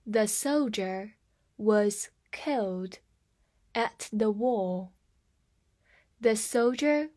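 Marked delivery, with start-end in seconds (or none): 0.0-4.9 slow, word groups split by pauses; slow read-aloud. Shown as a demonstration. Teacher models the sentence for learners, slow and measured. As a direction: read it slowly, phrase by phrase. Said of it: "我们慢慢来读一下".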